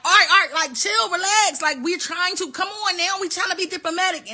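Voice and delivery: high-pitched hurried plea to calm down; placating. Acted. America tries to calm the quarrel.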